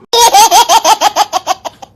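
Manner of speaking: high burst of laughter at the punchline; bright giggling laugh. Real, not acted. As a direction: break into a laugh after the joke.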